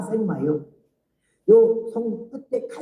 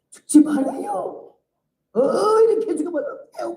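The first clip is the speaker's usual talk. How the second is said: tearful pleading; 10 semitones higher than usual. Retold or imitated. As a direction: beg her not to die, voice breaking high. Deaconess weeping over her bedside.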